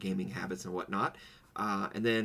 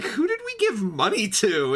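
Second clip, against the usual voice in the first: squeaky high incredulous voice, much higher and louder; high mock confusion; 12 semitones higher, 10 dB louder than usual. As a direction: play it as a baffled shriek. Retelling confused reaction.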